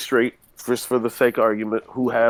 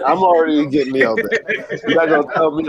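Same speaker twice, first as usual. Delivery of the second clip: laughing through the words, pitched up; 4 semitones higher than usual. Real, not acted. Laughing at a friend's [name] claim, amused. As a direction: talk through a burst of laughter.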